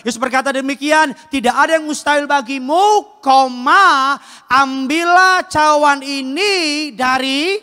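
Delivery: high, rising sermon voice; full-force preaching. Real, not acted. Preacher teaching the Gethsemane prayer to the congregation, fervent and high. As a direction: preach emphatically, stretching the key phrases.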